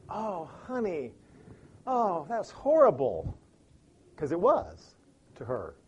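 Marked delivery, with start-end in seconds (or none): 0.1-3.4 sympathetic. Shown as a demonstration. Husband gently comforting wife about her bad day. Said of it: "here's a different way to do it".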